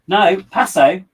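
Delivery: a sharp "no" at the pet; scolding the pet. Real, not acted. Pet reaching for something.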